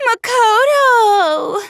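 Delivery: drawn-out high cry of the name; sleep-talking cry. Retold or imitated. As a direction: mimic him crying out in his sleep. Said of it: "You were talking in your sleep, saying".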